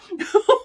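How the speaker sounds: loud, high burst of laughter; real startled giggle; embarrassed laugh. Real, not acted. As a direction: laugh out loud at your own slip. Laughs at her own swearing.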